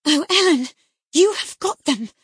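high, loud dismayed cry; distressed plea. A narrator's reading. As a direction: cry out, caught and frightened. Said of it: "she commenced immediately".

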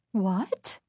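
soft, startled question; a small, taken-aback question with rising tune; surprised. A narrator's reading. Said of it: "She stopped in surprise".

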